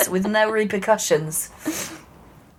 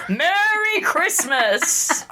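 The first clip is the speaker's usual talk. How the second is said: ironic cheer; 10 semitones higher, much slower than usual. Real, not acted. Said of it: "ironic cheer".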